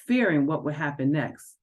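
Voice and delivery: ordinary delivery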